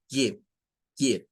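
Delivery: give, i quick and short. Shown as a demonstration. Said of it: "el sonido de esta es short".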